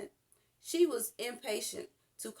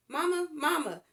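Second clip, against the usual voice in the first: higher and louder calling Mama; 7 semitones higher, 6 dB louder than usual. A narrator's reading. Girl calling her mother to come, loud call. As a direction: call across the yard for Mama. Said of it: "Pamela called".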